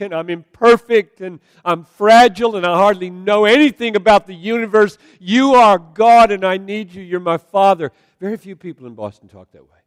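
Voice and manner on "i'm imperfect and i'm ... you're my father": impassioned, loud, high-pitched cry to God. Acted. Believer calling on God as Father, crying out. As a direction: cry out with full-force dependence. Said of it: "when you cry like that to your Father".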